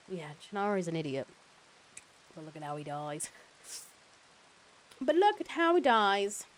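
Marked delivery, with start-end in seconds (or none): 2.3-3.3 soft, understated remark; hushed little comment; quiet aside. Pretend. Same remark repeated louder after. 5.0-6.5 high, loud, drawn-out mock delight; slow, over-the-top high voice; hammed-up exclamation. Repeats remark in hammed-up voice.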